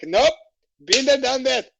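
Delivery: cheerfully done with it, out the door; breezy refusal. Retold or imitated. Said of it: "I was like".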